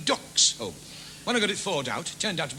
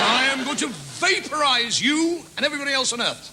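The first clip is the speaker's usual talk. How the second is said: loud, higher spaceman threat; menacing alien voice; 8 semitones higher, 5 dB louder than usual. Acted. Alien telling the comic he'll vaporize everyone, menacing loud voice. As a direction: boom it out like an invading alien.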